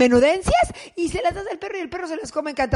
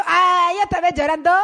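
mocking husband; 5 semitones higher, 5 dB louder than usual. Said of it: "te dice tu marido".

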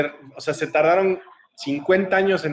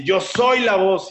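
higher, slow declaration with SOY stretched; imitated empowered shout; 7 semitones higher, 4 dB louder, slower than usual. Girl stands up and declares it, imitated shout. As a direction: proclaim it forcefully, imitating a defiant cry. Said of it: "párate y grita".

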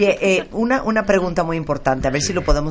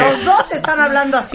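high-pitched, giggling through the words; laughing while talking; 11 semitones higher, 4 dB louder than usual. Real, not acted. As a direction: laugh as you say it. Host laughing at the guests.

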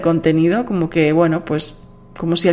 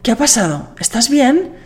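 concerned listener; 7 semitones higher than usual. Retold or imitated. Listener asking why the podcast is late, concerned. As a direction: imitate a listener checking she's fine. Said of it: "una persona me dijo".

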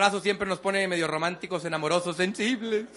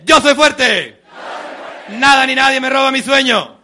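loud, higher shouted affirmations; strong affirmation shout; 4 semitones higher, 10 dB louder than usual. Shown as a demonstration. Speaker leads a motivational chant, shouting it loud. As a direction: proclaim every line with full force. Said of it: "repitan mis movimientos y mi tono de voz".

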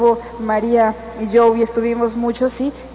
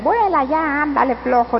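mock yell; 3 semitones higher than usual. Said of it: "no les gritan".

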